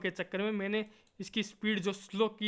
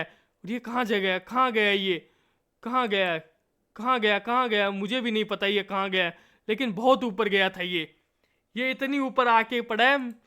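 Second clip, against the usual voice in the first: louder play-by-play with hammed drama; mock commentary; 5 dB louder than usual. Gamer mock-commentating his own shot flying to the moon, loud play-by-play. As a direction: narrate the shot like a sports commentator. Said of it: "commentary कर लो तुम तो इससे अच्छा".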